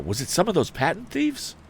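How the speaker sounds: high, urgent question again; eager question. A narrator's reading. Tom rereading the line to the wounded man, high. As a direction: press him urgently. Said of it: "cried Tom".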